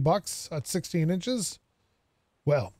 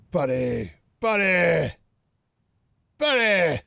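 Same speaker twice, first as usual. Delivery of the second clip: calling out, building; 3 dB louder, slower than usual. Pretend. Player calls to the shopkeeper to open up, insistent calling. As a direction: holler for a buddy, more insistent each time.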